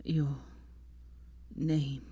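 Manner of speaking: low, weak, halting whisper; dying man's last effort; fading voice. A narrator's reading. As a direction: leave a long gap between faint words. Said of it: "he really started to fade".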